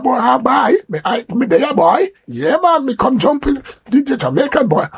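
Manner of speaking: high, sing-song mock Trini accent. A Trini bragging about joining the Jamaicans, imperfect Trini accent. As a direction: put on a rough, comic Trini accent. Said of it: "My Trini accent not sound too right".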